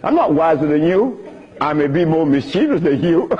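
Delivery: usual speaking voice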